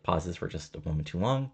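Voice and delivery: ordinary delivery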